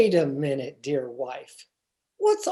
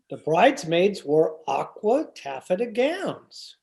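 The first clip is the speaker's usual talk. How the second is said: normal talking voice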